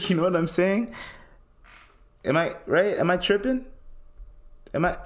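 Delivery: high, disbelieving questions; incredulous appeal. Real, not acted. Man asks viewers to back him up, high incredulous appeal. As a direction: ask it high, as if begging for agreement.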